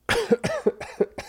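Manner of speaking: sharp cough after a sip; coughing on a strong drink. Real, not acted.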